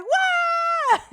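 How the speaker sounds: loud, very high mock cheer; crowd cheer. Whole room cheering for the star, shrieked.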